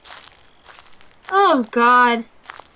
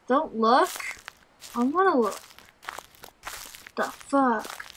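normal talking voice

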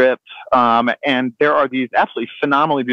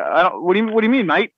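imitated Australian, baffled and higher; Australian accent; 5 semitones higher than usual. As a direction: play the confused Australian, pitched up. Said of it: "Well, your accent".

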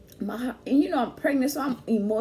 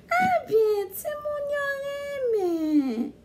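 much higher, very slow drawn-out voice; mock his mom; much slower than usual. Imitating his mom's reaction.

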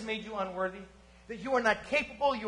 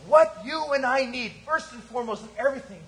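loud, higher, driving sermon voice; full-force preaching; 4 semitones higher, 4 dB louder than usual. Acted. Pastor exhorts the congregation about their greatest need, preaching hard. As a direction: preach it hard to the back row.

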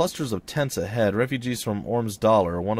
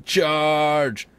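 high, loud rallying shout; battle cry; 6 semitones higher, 5 dB louder than usual. Acted. Leader answering hungry raiders with a battle cry. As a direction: call the troops into attack. War leader orders an attack.

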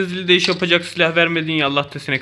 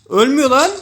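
sudden loud cry, pitch jumping up; frustrated shout; 8 semitones higher, 7 dB louder than usual. Real, not acted. Gamer yelling at the enemy who won't die, loud and high. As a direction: yell in disbelief that he won't go down.

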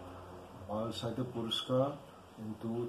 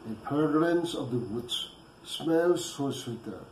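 louder, measured poetry reading with pauses; poem recitation; 2 semitones higher, 7 dB louder than usual. Acted. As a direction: declaim the poem phrase by phrase, projecting firmly. Recites own poem after introduction.

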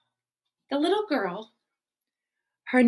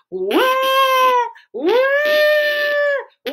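mock crying; 14 semitones higher, 8 dB louder than usual. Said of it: "And it was loud".

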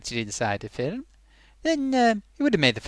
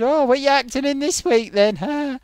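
teasing; 10 semitones higher, 3 dB louder than usual. Retold or imitated. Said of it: "they said".

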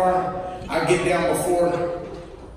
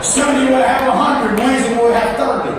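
loud, higher preacher's shout; preaching shout; 7 semitones higher, 4 dB louder than usual. Real, not acted. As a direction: call it out to the back pews. Preacher shouting a joke at the crowd.

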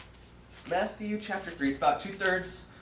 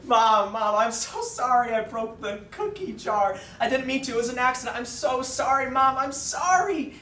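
high, hammed-up pleading wail; mock bawling; 8 semitones higher, 4 dB louder than usual. Preacher as his child self apologizing to mom, mock bawling. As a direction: imitate a bawling child begging forgiveness, building higher. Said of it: "I start bawling again".

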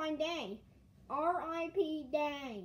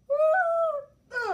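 high, loud sung meows; vocal cat piano meows; 14 semitones higher, 11 dB louder than usual. Acted. Playing a pretend cat piano, very high, loud meows.

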